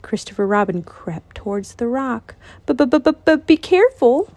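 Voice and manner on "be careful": slow, high, stammering warning; stuttering fear. Acted. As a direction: stammer it, scared stiff. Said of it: "warned Piglet".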